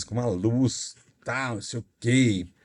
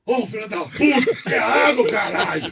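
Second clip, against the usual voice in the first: put-on drunk outraged; 7 semitones higher than usual. Drunk angry it's water.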